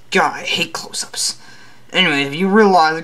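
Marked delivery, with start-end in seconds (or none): 0.1-1.4 whispering. Acted. Grumbling aside about the camera.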